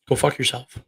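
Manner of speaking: usual speaking voice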